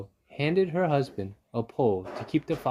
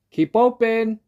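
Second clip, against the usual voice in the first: high, loud yell; yelled order; 12 semitones higher, 11 dB louder, slower than usual. Acted. Dentist yelling at the fox to keep his jaw open, high loud. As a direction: yell it up at him. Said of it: "yelled Doctor DeSoto".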